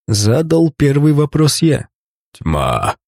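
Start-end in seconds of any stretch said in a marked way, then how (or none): 2.3-2.9 deep, slow rasping beast voice; hoarse monster wheeze. Acted. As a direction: wheeze it out low like a dying beast. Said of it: "прохрипел в ответ монстр".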